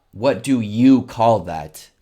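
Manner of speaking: slow and loud, lean on you; teacher's emphatic example. Shown as a demonstration. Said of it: "we're not literally saying".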